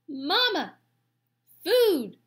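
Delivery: very high, loud chick squeal; hungry gosling. A narrator's reading. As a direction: peep the demand like a baby bird. Gosling calls for food.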